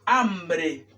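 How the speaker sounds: "Hambre" with a tapped R after b; "Hambre" as AM-bre; correct R. Shown as a demonstration. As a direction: say "Hambre" with R, not L. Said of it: "sino hambre".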